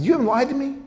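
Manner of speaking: high-pitched incredulous question; retold incredulous challenge; mock disbelief. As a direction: question it as if doubting every word. Retold challenge to a lying prisoner.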